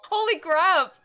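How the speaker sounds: loud and startled; startled exclamation. Real, not acted. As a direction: blurt it out in shock.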